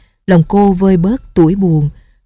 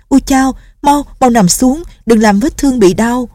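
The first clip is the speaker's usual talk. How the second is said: put-on higher urgent girlish cry; alarmed young nun; 6 semitones higher than usual. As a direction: hurry him to lie down. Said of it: "Nghi Lâm vội nói". (giọng cô gái cao, hốt hoảng)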